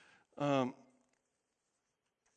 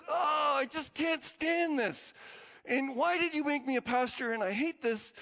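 frustrated outburst; 7 semitones higher than usual. Retold or imitated. Pastor complaining to God late at night, exasperated cry. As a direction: pour out your frustration at God.

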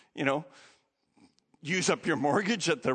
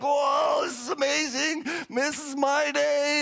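thrilled; 9 semitones higher than usual. Retold or imitated. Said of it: "They're like".

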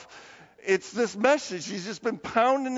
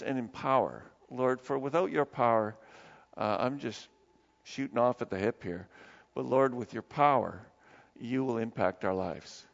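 soft, low, earnest prayer voice; 6 semitones lower, 5 dB quieter than usual. Acted. Pastor praying softly over the congregation's needs. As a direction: pray quietly and reverently for the church.

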